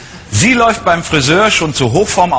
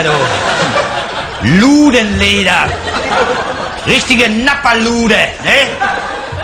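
proll car buyer; 9 semitones higher than usual. Acted. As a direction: gush over the new car like a show-off buyer. Same buyer character throughout.

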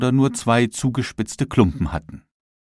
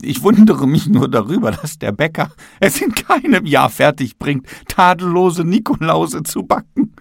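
high, apologetic voice of the bakery ceiling; the ceiling apologizing, raised and a bit louder; ceiling excusing itself; 7 semitones higher, 4 dB louder than usual. A narrator's reading. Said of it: "entschuldigte sich die Decke".

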